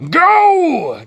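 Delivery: high, loud muffled shout. Acted. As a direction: bark the order from outside. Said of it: "voice muffled".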